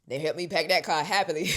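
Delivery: loud, laughing through the words. Real, not acted. Telling how family happily packed her car, laughing. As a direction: laugh it out, amused. Family glad she leaves.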